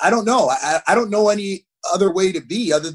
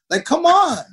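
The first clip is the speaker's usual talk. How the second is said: high, exasperated appeal; drawn-up, incredulous protest to the coach; exasperated plea; 12 semitones higher, 4 dB louder, slower than usual. Retold or imitated. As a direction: plead in frustration, pitch jumping way up. Acted plea to his coach.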